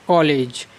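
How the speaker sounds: कॉलेज as English "kaw-lej"; English pronunciation. Shown as a demonstration. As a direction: open rounded "aw" in कॉलेज. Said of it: "अंग्रेजी में इसका उच्चारण किस प्रकार से होता है".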